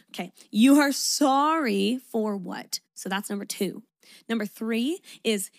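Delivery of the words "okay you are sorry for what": loud, slow, emphatic demand; retold pissed-off challenge. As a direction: re-enact an exasperated challenge, higher and stretched out. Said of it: "pissed off 'cause I'd be like".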